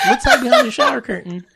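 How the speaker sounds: high, giggling mock of childish play; mock yourself through laughter; laughing. Mocking own silly search.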